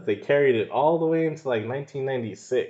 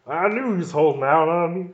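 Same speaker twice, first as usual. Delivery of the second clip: stiff, overacted line reading, pitched up; bad acting; 7 semitones higher, 4 dB louder than usual. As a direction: ham up a wooden movie line. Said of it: "The line delivery was so bad".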